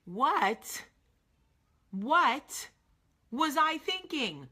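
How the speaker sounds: high, rising disbelief at her past self; incredulous. Retold or imitated. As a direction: look back and can't believe it. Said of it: "eventually look back on the relationship just like I do and go".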